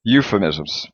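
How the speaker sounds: euphemisms said at natural speed, one smooth word. Shown as a demonstration. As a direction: run euphemisms together quickly. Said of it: "let's say it a little bit faster".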